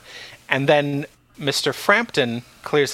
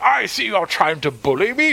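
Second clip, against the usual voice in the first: higher, mock-wounded stuffy voice; indignant chairman; 6 semitones higher than usual. Chairman whining at Catherine's threat, mock indignant. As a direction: play the offended bully victim.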